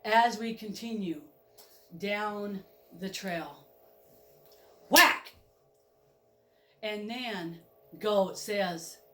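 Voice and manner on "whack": much louder sharp impact word; loud whack. Pretend. Narrator voices the Reaper's hit, loud. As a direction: slam it out like a blow.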